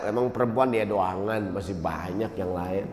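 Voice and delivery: low, hushed show of not caring; acting tough. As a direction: brave front, softly shrugged off. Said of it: "Di luar dia kelihatan tegar ya".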